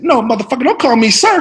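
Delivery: loud, high, irritated star actor; Sam Jackson impression. Veteran actor correcting the newcomer, irritated impression. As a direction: scold the rookie forcefully. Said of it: "He said".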